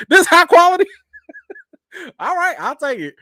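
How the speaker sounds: high, loud laughing squeal. Real, not acted. Host laughs at a viewer's high-quality praise. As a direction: cackle at the compliment.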